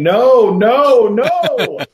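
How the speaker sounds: high, loud panicked no, no, no; frantic yelling. Retold or imitated. Dad yelling at his son to stop the trailer, panicked shout. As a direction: shout it as the pigs bolt out.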